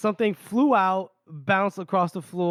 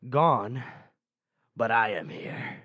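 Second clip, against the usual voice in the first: sinister whisper; 7 semitones lower, 3 dB quieter, slower than usual. Acted. Demon voice in the dark.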